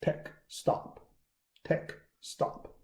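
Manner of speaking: "tech" softly, ending dead on K; stop after K. Shown as a demonstration. Teacher drills the correct ending, "tech" softly as tek. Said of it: "Just tech".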